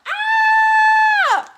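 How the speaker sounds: high, loud playful scream; sudden high shriek; mock scream. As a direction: shriek in play alarm. Scream before robot arrives.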